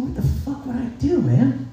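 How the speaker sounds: comic bewildered worry; mock panic. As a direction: fret in a high, comically freaked-out voice. Acted panic over meeting chiseled dude.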